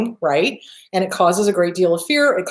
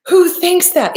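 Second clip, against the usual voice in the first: much higher, louder outraged who thinks that; louder incredulous repeat; 9 semitones higher, 5 dB louder than usual. Real, not acted.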